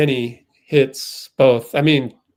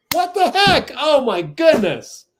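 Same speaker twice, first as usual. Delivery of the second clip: outraged disbelief; 11 semitones higher, 5 dB louder than usual. Real, not acted. Fan outraged at missed foul call.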